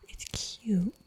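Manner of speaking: ordinary delivery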